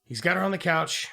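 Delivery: ordinary talk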